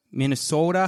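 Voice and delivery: playful mock Minnesotan, long O; Minnesota accent. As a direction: slip into a fake Minnesota accent. Returns to the accent joke.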